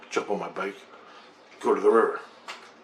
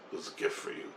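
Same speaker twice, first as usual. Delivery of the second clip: 1 hushed quote; 10 dB quieter, faster than usual. Retold or imitated. Quoting the aliens' message.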